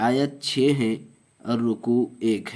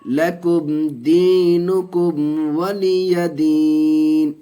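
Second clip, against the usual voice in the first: slow, high, drawn-out chanted recitation; Quranic recitation; 3 semitones higher, 5 dB louder, much slower than usual. Shown as a demonstration. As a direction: intone each syllable long and melodic like tajweed recitation. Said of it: "teacher recites verse".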